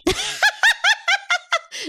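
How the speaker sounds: high laugh. Real, not acted. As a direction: burst of high-pitched laughter. Reaction to odd name.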